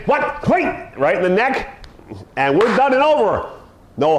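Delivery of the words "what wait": startled dog. Retold or imitated.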